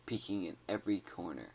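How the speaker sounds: ordinary delivery, nothing marked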